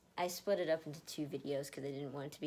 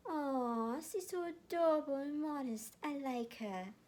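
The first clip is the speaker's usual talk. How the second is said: higher, doting coo; 9 semitones higher, 3 dB louder, slower than usual. A narrator's reading. Kilo, adoring Erica, cooing in a lightly voiced higher tone. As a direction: coo over her like a sweet puppy. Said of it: "Kilo cooed".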